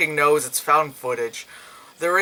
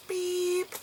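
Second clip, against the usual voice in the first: electronic beep; 9 dB quieter than usual. Retold or imitated. Imitating the Simon game tone.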